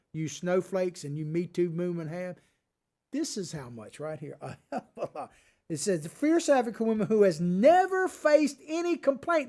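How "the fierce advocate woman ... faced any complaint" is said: scream. Real, not acted. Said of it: "I started to scream this".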